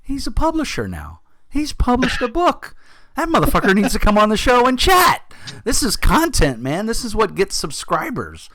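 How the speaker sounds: mock-excited high voice, as if thinking out loud; excited realization. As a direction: squeal it like a sudden brilliant idea. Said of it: "and I'm like".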